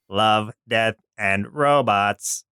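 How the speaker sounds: slow robotic voice with odd enunciation. Acted. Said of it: "enunciate in weird places".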